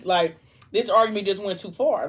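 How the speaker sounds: normal talking voice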